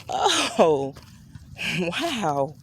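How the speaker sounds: drawn-out oh, then a small chuckled wow; disbelieving chuckle. Retold or imitated. Mother reacting to daycare's news of the firing, disbelieving chuckle. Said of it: "unbelievable, like, chuckle".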